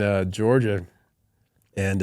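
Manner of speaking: ordinary talk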